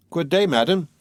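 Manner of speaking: plain reading voice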